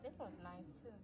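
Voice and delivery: far from mic. Real, not acted.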